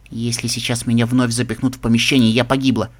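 plain reading voice

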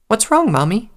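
high-pitched little filly voice; little child. A narrator's reading. Little filly asks her mother what is wrong, childlike. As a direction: ask it like a concerned small child.

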